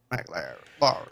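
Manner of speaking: deep, low, sultry name drop; low and sultry, a mock sexy Mike Lowry. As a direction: purr the name like a smooth ladies' man. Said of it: "You don't sound sexy at all".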